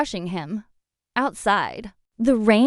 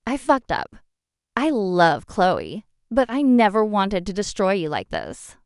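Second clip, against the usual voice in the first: ordinary delivery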